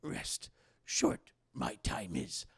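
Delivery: very low, slow, hoarse Yoda croak. Retold or imitated. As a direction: sink the voice down like a tired little old master. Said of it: "He's like".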